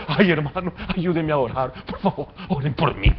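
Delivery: mock whining. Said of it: "No ande lloriqueando".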